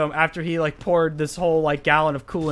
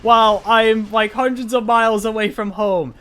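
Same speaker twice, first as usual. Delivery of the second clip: livid; 5 semitones higher, 5 dB louder than usual. Real, not acted. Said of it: "I was so livid".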